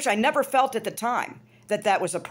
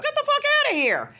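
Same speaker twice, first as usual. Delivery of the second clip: much higher, disbelieving blast; incredulous outburst. Real, not acted. Scoffing at parents hiding adoption, incredulous outburst. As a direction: throw it out in disbelief. Outraged dismissal.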